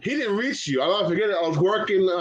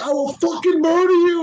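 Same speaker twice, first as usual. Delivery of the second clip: high, loud imitated threat; hammed-up murderous yell; mock angry threat; 10 semitones higher, 5 dB louder than usual. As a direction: bark the threat like an enraged wrestler. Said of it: "He's like".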